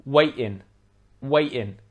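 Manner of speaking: waiting with a glottal stop in place of T. Shown as a demonstration. Teacher showing the glottal stop, waiting without T. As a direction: drop the T in waiting, catch the air. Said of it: "With the glottal stop".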